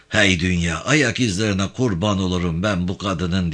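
ordinary delivery, nothing marked

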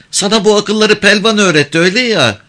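put-on high-pitched, pert girl's voice; high young voice standing up to a man; defiant village girl. A narrator's reading. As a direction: challenge him in a girl's high voice.

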